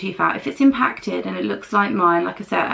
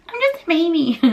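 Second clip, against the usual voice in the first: high, babyish mock whine; 9 semitones higher than usual. Shown as a demonstration. Vlogger mocking herself, in a high baby voice. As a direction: squeak it like a toddler. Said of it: "Judge me all you like".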